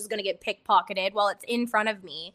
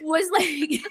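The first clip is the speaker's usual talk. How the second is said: laughing; 6 semitones higher than usual. Real, not acted. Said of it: "laughing at herself".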